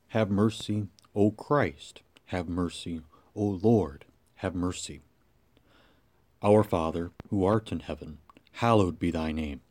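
reciting the Creed and prayers in a low, soft voice; liturgical prayer. Acted. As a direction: pray it quietly, with reverence. Congregational prayers after the reading.

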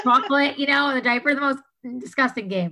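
tickled and grossed out; amused disgust. Real, not acted. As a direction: laugh through the gross detail, voice lifted.

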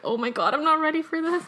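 a touch of dismay, voice lifted; retold panic. Learner tells of facing her driving test, mildly panicked.

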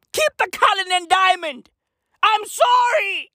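hammed-up shouted apology; mock apology. Comedian apologising to Queen Elizabeth, hammed-up yell.

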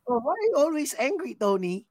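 high, playful mock-accusing voice; ribbing a coworker in a squeaky high voice; teasing question. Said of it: "Sabihin ko lang".

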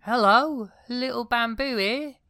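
put-on high, loud girlish letter voice; bamboo princess letter. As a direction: read it as a chirpy young princess. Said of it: "this is the synopsis of the movie".

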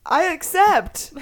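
high, loud and drawn out; slow, high-pitched jubilant cry; delighted acceptance. Real, not acted. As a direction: accept it with over-the-top glee. Accepting the waffle maker offer.

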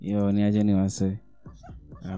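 ordinary talk

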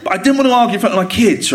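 ordinary talk

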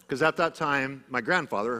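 ordinary talk, nothing marked

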